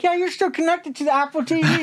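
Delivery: high, put-on telling-off; mock scolding reveal. Wife telling him his phone is on TV, mock high scolding.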